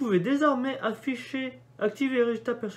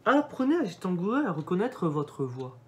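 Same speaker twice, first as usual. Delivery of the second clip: ordinary talk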